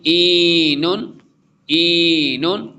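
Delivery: "ईनुन" with a stretched ee, slow; long vowel. Shown as a demonstration. Teacher demonstrating the long vowel to the student, slowly. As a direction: draw out the ee. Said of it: "या मद्दा है".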